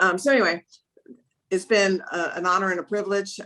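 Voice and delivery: normal talking voice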